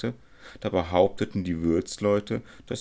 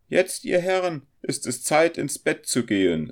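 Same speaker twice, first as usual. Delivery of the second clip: high, loud landlady voice; insistent hostess calling time; bossy innkeeper; 11 semitones higher, 6 dB louder than usual. A narrator's reading. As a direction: order the guests off in a raised pitch. Innkeeper orders guests to bed.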